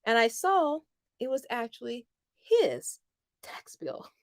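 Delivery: breathy hushed reveal; dramatic whisper. Real, not acted. Reveal of the mix-up.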